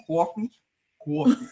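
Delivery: "coffee" with a rounded New York "kwaw" vowel; New York accent. Shown as a demonstration. Host showing how she says it, New York accent "coffee". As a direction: show off the New York "cawfee". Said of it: "mine sound like with a W".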